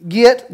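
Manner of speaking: "get" said as "git", with a short i; Southern "git". Shown as a demonstration. Pastor giving the Southerner's commandment, "get" drawn out as "git". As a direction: say "get" to rhyme with "sit". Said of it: "get, G I T".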